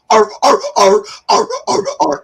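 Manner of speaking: loud, high-pitched sea lion barks; rhythmic imitated animal yelps; sea lion barking. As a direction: bark out the carol like a performing sea lion. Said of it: "la la la la's with the barking".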